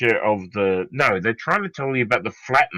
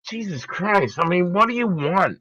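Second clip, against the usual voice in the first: exasperated; 7 semitones higher than usual. Real, not acted. Exasperated at opponent's point.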